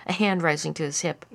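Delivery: plain reading voice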